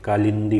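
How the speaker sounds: slow "कालिंदी" with a clear "lin"; careful, drawn-out "कालिंदी"; correct form "कालिंदी". Shown as a demonstration. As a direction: say "कालिंदी" with short i before the n. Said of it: "कालंदी ऐसा नहीं लिखा था".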